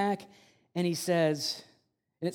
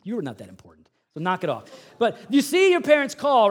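ordinary delivery

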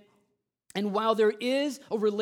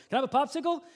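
put-on higher, hopeful kid's request; eager kid; 9 semitones higher, 4 dB louder, much faster than usual. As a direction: imitate a boy angling for a treat. Boy eagerly asks for popsicle.

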